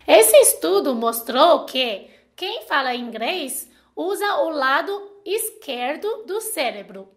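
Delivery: ordinary talk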